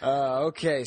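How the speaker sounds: ordinary talk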